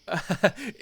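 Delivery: short laugh breaking in; a young man's amused laugh, interrupting; bursting laugh. Acted. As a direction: let a quick laugh burst out. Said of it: "a third laugh burst through their bubble".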